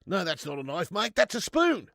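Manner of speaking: broad Australian, rising high and loud on spoon; mock-outraged Aussie, punching the last word; Aussie impression. Streamer correcting a mate about cutlery, exaggerated Australian accent. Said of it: "Do an Aussie impression?".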